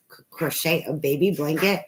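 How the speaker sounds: ordinary talk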